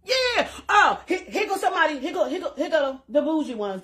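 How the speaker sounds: mock admiring onlookers. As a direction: play the impressed girls who spot the jeans. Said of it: "This is what they gonna say".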